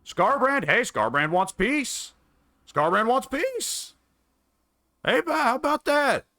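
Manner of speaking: high-pitched, drawn-out excited cry; excited surprise. Real, not acted. Player seeing an enemy suddenly offer peace, excited surprise. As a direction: exclaim it high in delighted surprise.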